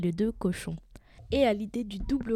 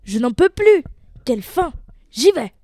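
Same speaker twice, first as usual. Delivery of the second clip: very loud, high outburst; hungry wolf yelling at full force; impatient wolf shout; 6 semitones higher, 14 dB louder than usual. Acted. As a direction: explode with ravenous impatience. Hungry wolf loses patience, then jumps.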